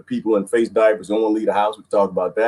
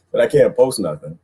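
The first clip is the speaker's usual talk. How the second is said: ordinary delivery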